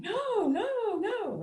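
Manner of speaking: high, startled no no no; horrified protest. Retold or imitated. New mother horrified by her mentor's warning, high startled protest. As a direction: refuse it in disbelief, way up high. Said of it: "And I was like".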